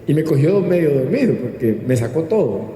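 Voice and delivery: normal talking voice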